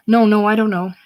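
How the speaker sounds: loud, high protest; frightened denial. Acted. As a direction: protest your innocence in alarm. Frightened boy denies under threat.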